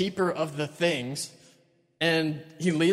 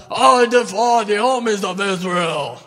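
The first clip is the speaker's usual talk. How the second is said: slow, much louder booming giant; Goliath yelling; 3 semitones higher, 8 dB louder, slower than usual. Acted. Giant calling down the hill, slow loud bellow. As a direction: bellow the challenge slowly. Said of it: "he yells out".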